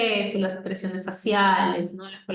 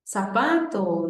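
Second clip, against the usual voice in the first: put-on slow modelling for a toddler; slow clear demo; much slower than usual. Shown as a demonstration. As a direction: stretch "Zapato" slowly for the child. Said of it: "yo le hacía lo mismo".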